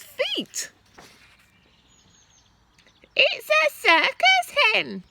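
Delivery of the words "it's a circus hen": very high, loud, slow granny shriek; shouting Grandma. A narrator's reading. Grandma shouting from the rooftop at the hen. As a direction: crow it down like a gleeful old woman. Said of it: "Grandma shouted from the rooftop".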